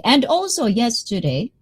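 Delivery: usual speaking voice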